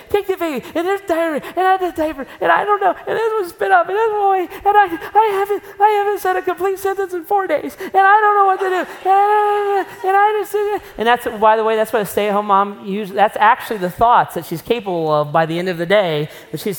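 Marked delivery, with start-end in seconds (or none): 0.1-10.5 a worn-out mother rattling off chaos at the door; frazzled mom. Pretend. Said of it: "and she's like".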